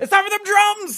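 high, loud hype call; hype announcer. Pretend. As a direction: announce the drums like a party host. Hyping drum segment, high voice.

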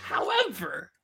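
high, loud, drawn-out retort; transformed ballistic voice. Real, not acted. Host defending the forecasters' record, going ballistic. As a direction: pitch it way up and stretch it out. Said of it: "Listen to that voice. It just transformed".

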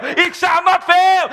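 high, chant-like preaching over music, building to a shout; fervent declaration. Acted. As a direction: proclaim it like a king, rising. Preaching declaration at full force.

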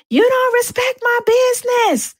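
high, loud frantic yell; high-pitched accusing shout at the boyfriend; crazy person yelling. Retold or imitated. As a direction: shout it like you've lost it. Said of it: "I sounded like a crazy person".

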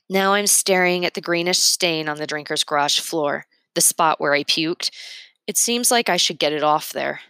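plain reading voice

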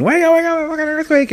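shrill high panicked yell of wake up; imitating a roommate screaming in terror. Roommate bursting in during an earthquake, high panicked scream. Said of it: "into my room screaming".

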